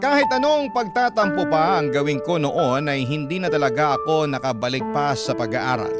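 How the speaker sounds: usual speaking voice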